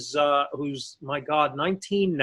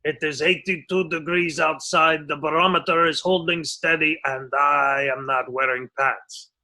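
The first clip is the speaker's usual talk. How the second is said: captain impression; 4 semitones lower than usual. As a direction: drone it like a pompous captain announcing. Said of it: "he sounded exactly like this".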